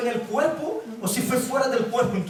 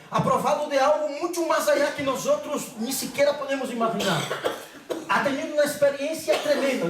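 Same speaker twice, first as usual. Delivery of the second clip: "tremenda" stressed, pitched high; "mucho más allá" and "tremenda" heavily emphasized; greatest possible emphasis; 5 semitones higher than usual. Real, not acted. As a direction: drive home "tremenda" with raised, emphatic pitch. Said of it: "intentando aquí poner más énfasis posible".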